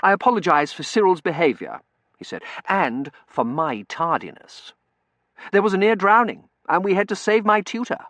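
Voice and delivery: earnest, high-pitched explanation; earnest young gentleman. A narrator's reading. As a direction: apologise eagerly, at full voice.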